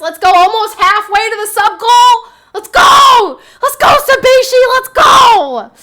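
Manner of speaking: very loud, high-pitched hype shout; excited cheering. Real, not acted. Streamer thanking viewer for gifted sub, excited shouting. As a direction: yell it like a streamer celebrating.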